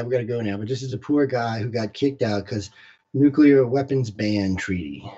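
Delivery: ordinary talk, nothing marked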